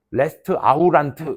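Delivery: 레스트 아우란트 sounded letter by letter in Korean syllables; spelling pronunciation. Shown as a demonstration. Pupil memorising the spelling, literal 레스트 아우란트. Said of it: "이렇게 외웠단 말이야".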